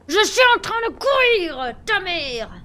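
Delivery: loud, high, slow outburst; exasperated shout. Real, not acted. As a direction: yell it out in frustration. Frustrated with slow game running.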